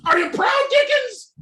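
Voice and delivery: manic ranting villain. Acted. Unmasked Ted raves at Dave Dickens, hysterical laughing rant. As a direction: rave in giddy hysterical relief.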